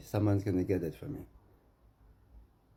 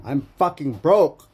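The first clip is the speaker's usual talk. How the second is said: slow, furious yell, much higher and louder; angry shout; 7 semitones higher, 12 dB louder, slower than usual. Real, not acted. A broke man yelling at the man who took his money, furious. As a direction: roar it out, pushed to the edge. Ranting about stolen money.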